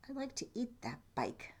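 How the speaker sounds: softly whispered goat thought; sly whisper. Acted. Goat secretly eyes the bike, whispering.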